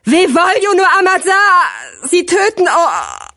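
long, high, drawn-out cry cut off at the end; desperate scream. A narrator's reading. Sirio's last warning to his dying friend, screaming. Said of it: "war Sirios Schrei".

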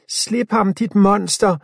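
high loud furious yell; angry shout. A narrator's reading. Girl screaming at the zombie holding Milo. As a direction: scream at the monster. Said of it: "råber Afia".

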